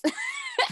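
a shrill burst of laughter, very high; high squealing giggle. Real, not acted. High laugh after sass.

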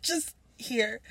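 soft, deadpan pretend abuser; hushed mock-casual murmur; mock offering. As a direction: quietly play at handing over a token gift. Friend mimics the ex.

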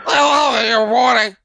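slurred, sloppy operator line; mumbling drunk operator. Retold or imitated. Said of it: "Could you articulate?".

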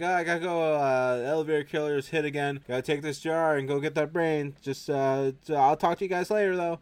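loud, high, urgent exit lines; urgent calling out. Retold or imitated. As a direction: call it out as you rush off. Acted doctor rushing off after call.

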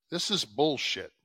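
ordinary talk

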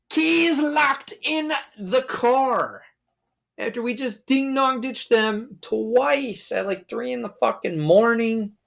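high, slow, put-on voice wailing the disaster; mock dramatic despair. Realizing the keys are locked in the car, high slow mock wail. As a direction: stretch it out like a tragic announcement.

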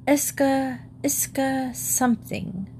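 high, halting try at a word; unsure attempt. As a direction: grope for a half-remembered foreign word. Paddington gropes for the word.

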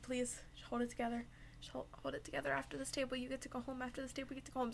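soft, hushed, soothing; calming plea. Retold or imitated. Manager soothing an upset server before the last table, soft reassuring. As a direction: quietly talk her down.

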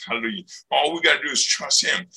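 ordinary talk

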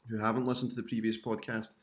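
ordinary talk, nothing marked

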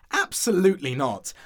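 firm, somewhat loud objection; emphatic, indignant no; firm refusal. Acted. Ghost objects to apricot walls.

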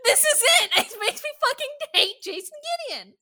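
high, fast rant through laughter; laughing exasperation. Real, not acted. Host explaining why they hate Gideon, laughing exasperation. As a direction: vent quickly while laughing. Ranting about hated character, laughing.

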